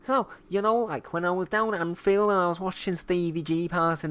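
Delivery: hammed-up Liverpudlian; mock Liverpool accent. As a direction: ramble through a tall tale in exaggerated Scouse. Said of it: "is a Liverpoolian accent".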